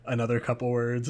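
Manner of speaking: ordinary talk, nothing marked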